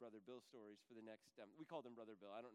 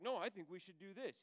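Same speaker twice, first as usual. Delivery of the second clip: raised, louder push-back; insistent objection; 4 semitones higher, 8 dB louder than usual. Retold or imitated. Rival in the group pushing his own plan, insistent. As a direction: argue for your own plan, voice up. Other guy objecting in the story.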